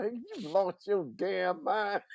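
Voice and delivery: low, slow words through clenched teeth. Retold or imitated. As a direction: scold without opening your jaw. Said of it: "talk through clenched teeth".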